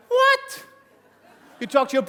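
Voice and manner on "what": very high squeaked what; mock disbelief from the spouse; incredulous spouse. Spouse hearing the plan, incredulous squeak. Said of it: "they're like".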